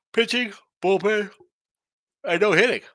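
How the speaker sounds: yawning through the words. Real, not acted. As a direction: talk mid-yawn. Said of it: "Sorry for the yawn".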